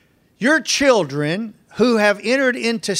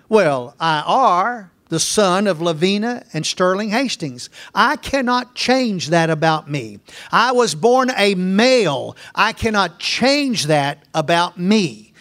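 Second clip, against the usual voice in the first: ordinary talk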